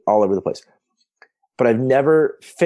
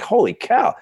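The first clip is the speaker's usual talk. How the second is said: sudden high-pitched amazed exclaim; retelling his stunned reaction to Harvard; holy cow reaction; 11 semitones higher, slower than usual. Retold or imitated. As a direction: gasp it out in disbelief, much higher. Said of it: "I'm like".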